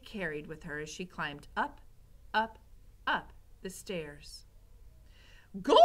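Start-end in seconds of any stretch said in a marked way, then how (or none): 5.6-5.8 put-on loud exclamation from a coarse serving girl; shouting. A narrator's reading. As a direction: shout it out to the rat. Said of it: "she shouted to the rat".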